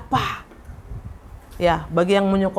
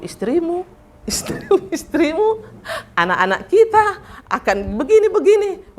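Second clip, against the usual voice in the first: half-laughing mock lament; 8 semitones higher than usual. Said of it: "yang abang Pini pikirkan".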